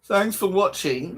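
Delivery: slow sarcastic parody of a sign-off; mocking the TV host. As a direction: drag it out like a smug presenter. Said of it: "What an absolute muppet".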